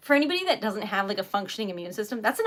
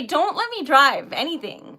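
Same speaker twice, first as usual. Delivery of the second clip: ordinary talk, nothing marked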